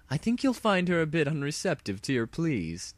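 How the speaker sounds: high, smug mad princess; a hint of gloating menace; lightly voiced smug villain. Villain mocking the hero's pleas, lightly smug.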